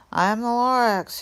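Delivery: high, loud wheezy creature voice; a fussy little creature announcing himself; Lorax voice. Acted. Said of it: "he coughed and he whiffed".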